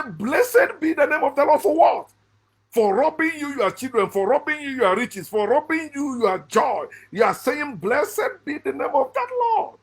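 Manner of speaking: high-pitched, incredulous protest at pious praise; indignant mockery. Ex-believer challenging believers who praise God after losses, indignant mockery. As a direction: throw the pious words back in disbelief, pitched high.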